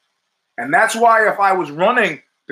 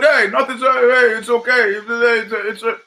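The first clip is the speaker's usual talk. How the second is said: mock flustered excuse; 6 semitones higher than usual. Mimics the show's excuse.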